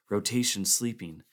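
ordinary delivery

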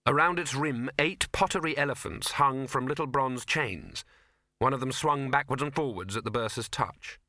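usual speaking voice

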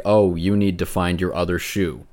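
ordinary talk

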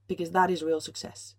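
ordinary delivery

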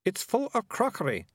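put-on high-pitched, bewildered girl; puzzled protest in a high voice; girl who can't make sense of things. A narrator's reading. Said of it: "now quite bewildered".

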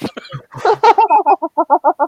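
high burst of laughter; loud laughter. Real, not acted. Big laugh at the joke.

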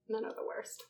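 breathy whispered jab; unvoiced whisper of complaint; whispered aside. Real, not acted. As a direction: whisper it under your breath. Whispered joke.

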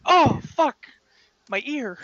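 high, loud startled cry; a character's loud yelp when the door hits; pained yelp. Acted. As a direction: yelp in sudden pain. Ear slammed by door.

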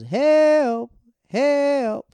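very high, loud, stretched-out wail; Tin Man's cry for help. Retold or imitated. Acting out a scene from The Wiz, hammed-up cry for help. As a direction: imitate a trapped movie character calling out. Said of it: "I remember the scene goes".

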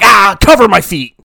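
high, loud yelp; comic shouted outburst; mock panicked cry. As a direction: blurt it out in exaggerated alarm. Acts out a panicked joke line.

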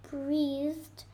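ordinary delivery

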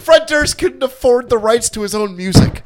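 shouted, high and loud with laughter; loud incredulous shout; loud outburst. Real, not acted. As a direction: blurt it out in a burst of laughing disbelief.

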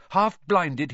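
ordinary delivery

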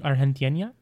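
"Argentina" with a breathy H for G and a "nya" ending; Spanish-style pronunciation. Real, not acted. Asking a friend about countries included, "Argentina" said as "Ar-hen-tee-enya". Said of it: "put a nya on it".

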